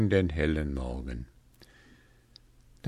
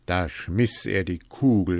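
plain reading voice

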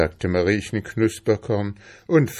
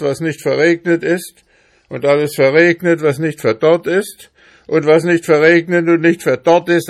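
loud, aggrieved rant climbing in pitch; blustering hamster refusing to share; 8 semitones higher, 6 dB louder than usual. Acted. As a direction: build from grumbling to heated complaint. Hamster rants in the story.

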